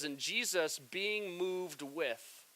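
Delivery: soft, slow, hushed reading; reading scripture softly. Shown as a demonstration. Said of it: "It says".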